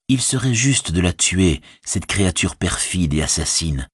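usual speaking voice

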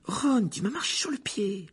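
a startled hushed protest; exclaiming. Acted. Hermione scolds Ron for stepping on her foot, startled whisper. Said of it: "s'exclama Hermione".

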